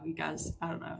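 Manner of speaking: ordinary talk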